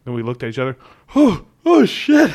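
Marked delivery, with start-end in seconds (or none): 1.1-2.3 high, loud, slow "oh, oh shit"; dawning panic. Retold or imitated. Two friends realise their mistake, loud slow panic. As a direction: let the dread hit slowly, up high and loud. Both realise the tags are missing.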